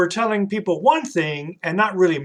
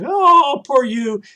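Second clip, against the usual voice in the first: mock sympathy; 9 semitones higher, 4 dB louder, slower than usual. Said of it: "they're really insincere".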